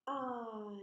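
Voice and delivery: I drawn out, ah gliding to ee; long vowel I. Shown as a demonstration. Teacher shows the changing mouth, I stretched out. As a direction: hold the letter name I long. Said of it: "This long vowel sound also changes".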